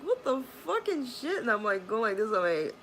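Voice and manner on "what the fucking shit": high squealing what the fucking shit; mock outrage, high pitched; shrill disbelief. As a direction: squeak it out in shock. Retold saline rinse reaction.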